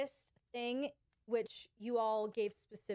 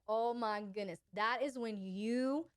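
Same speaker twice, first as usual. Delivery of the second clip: ordinary talk, nothing marked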